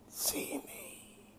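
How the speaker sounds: soft, breathless whispering demon; demon king whisper. A narrator's reading. Demon king whispering inside Drake's head, menacing whisper. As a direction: hiss it like a voice inside his head.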